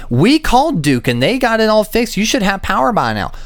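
sing-song imitation of an excuse; mock leasing agent insisting they fixed it. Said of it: "mimicking leasing office excuse".

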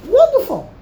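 high mock-approving wonderful; ironic wonderful. Real, not acted. Preacher mock-praising those who take a stand. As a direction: praise it with a sly twist.

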